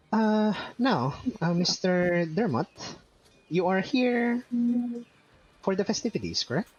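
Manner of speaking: high, eager little goblin; squeaky high goblin talking to the priest. Acted. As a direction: pitch it way up like a nervous goblin. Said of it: "he turns to the goblin beside you, and he says".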